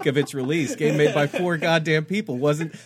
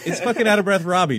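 laughing; 6 semitones higher, 7 dB louder, faster than usual. Real, not acted. Laughing mock echo of co-host.

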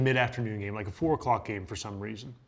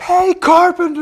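much higher, much louder yell of a name; bullhorn yell; 16 dB louder than usual. Retold or imitated. Fan above the player yelling down at him like a bullhorn. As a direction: boom it out like a bullhorn from the deck above. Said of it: "it sounded like it came out of a bull, bullhorn".

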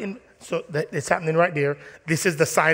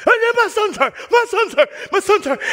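high, loud panicked cries; frantic mom; 8 dB louder than usual. Retold or imitated. Mom on the field crying to the referee, frantic. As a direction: wail like a frantic mother.

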